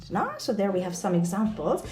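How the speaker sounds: usual speaking voice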